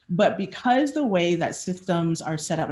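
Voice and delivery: ordinary delivery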